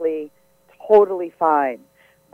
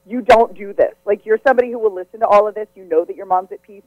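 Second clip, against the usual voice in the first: usual speaking voice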